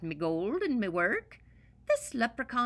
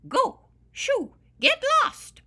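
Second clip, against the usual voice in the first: much higher, much louder shooing yells; furious shout; 12 dB louder, slower than usual. Acted. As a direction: shout them away with all you've got. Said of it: "I shouted".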